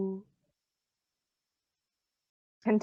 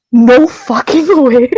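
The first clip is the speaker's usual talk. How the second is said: gasp, then stunned disbelief; shocked disbelief; 6 dB louder, slower than usual. Real, not acted. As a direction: react to a miracle roll. Player just rolled a natural twenty.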